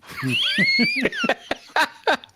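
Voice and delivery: real laugh that rises to a high shriek. Real, not acted. Group cracks up at joke.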